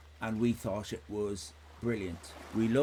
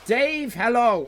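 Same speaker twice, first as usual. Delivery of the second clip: loud drawn-out hello; big slow greeting; 11 semitones higher, 10 dB louder, slower than usual. Real, not acted. Streamer greeting a viewer, big slow hello. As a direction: call out warmly across the room.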